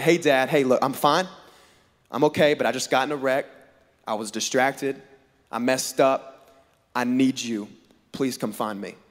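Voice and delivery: low, subdued admission, softening to a plea; honest confession. Pretend. Son confessing the wreck to dad, low and sincere. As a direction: own up plainly and quietly ask for help. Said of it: "I could have been like".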